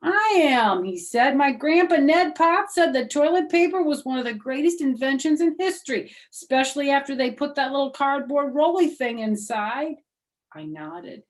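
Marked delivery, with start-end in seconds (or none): 0.0-10.0 high, loud and keen, bursting with pride; a boy thrilled to share grandpa's wisdom; eager boy. Acted. Sheldon enthuses about toilet paper.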